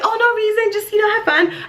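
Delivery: high, breezy mock-innocent mom; excited mom imitation. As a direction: imitate a giddy mom covering up a secret. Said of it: "why are you so excited".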